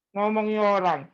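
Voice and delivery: ordinary delivery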